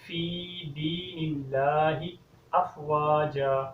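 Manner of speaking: فی drawn out with a long fa vowel, recited slowly. Shown as a demonstration. Teacher shows فی with the vowel lengthened. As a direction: stretch the fa in فی. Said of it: "فا کو لمبا کرنا ہے،".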